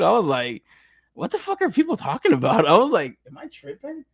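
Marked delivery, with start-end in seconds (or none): 1.1-2.7 high-pitched disbelieving question; mock bafflement. Creator retelling his reaction to head sculpt critics, baffled. 3.3-4.0 very soft, high-pitched aside; hushed self-doubt. Retold or imitated. Creator asking himself if he is tripping, hushed doubt.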